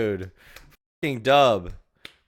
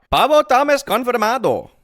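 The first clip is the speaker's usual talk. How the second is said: mock Spanish accent; 10 semitones higher, 6 dB louder, slower than usual.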